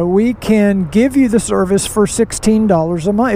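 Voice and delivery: ordinary talk, nothing marked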